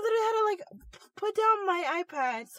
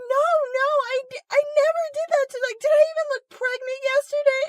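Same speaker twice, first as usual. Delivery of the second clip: much higher, louder, hurried protest; indignant denial; 14 semitones higher, 8 dB louder, faster than usual. Retold or imitated. Retsuko denying she gave birth, flustered protest. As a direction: protest fast, offended and flustered. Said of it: "she's like".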